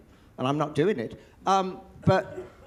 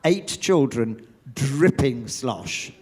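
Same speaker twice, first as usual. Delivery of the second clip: ordinary talk, nothing marked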